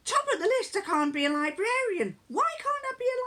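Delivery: high, loud mock-indignant son; mimicked son. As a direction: pitch it up as the outraged teenager. Son outraged at job list.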